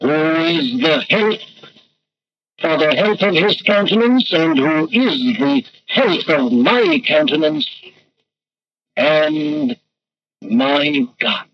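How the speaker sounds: very slow, each phrase set apart; slow, grave psalm recitation; solemn scripture quote. Retold or imitated. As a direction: declare the psalm line by line. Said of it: "you say with this man".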